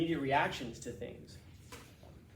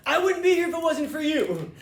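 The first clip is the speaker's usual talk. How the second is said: much higher and louder, breathless excitement; giddy winner's speech; 13 semitones higher, 10 dB louder than usual. Retold or imitated. Winner thanking the cheering restaurant, loud giddy excitement. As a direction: gush like a surprised award winner. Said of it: "He's all".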